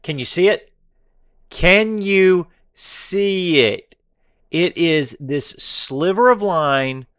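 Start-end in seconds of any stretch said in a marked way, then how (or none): 1.5-7.1 slow, higher and louder, teasing; slow taunting drawl. Acted. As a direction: stretch every word out, mocking. Drawn-out taunt over chart.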